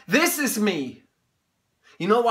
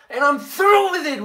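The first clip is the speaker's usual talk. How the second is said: higher and louder, defiant; loud outburst; 6 semitones higher, 5 dB louder than usual. Acted. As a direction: throw it at him with force. Son breaks with his father.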